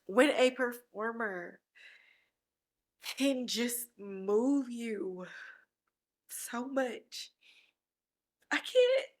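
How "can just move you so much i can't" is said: slow, fading, tearful and soft; choked up. Real, not acted. Host moved by a performer's acting, choked up. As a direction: trail away as the tears win. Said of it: "I'm getting so emotional".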